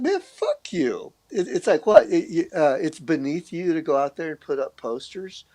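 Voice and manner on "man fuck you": incredulous scoff. Retold or imitated. Retorting to the college-degree guy.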